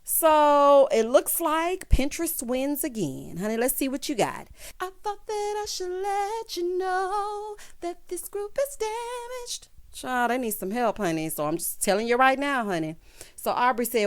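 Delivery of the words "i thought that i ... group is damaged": sing-song reading. Pretend. Reading a card aloud.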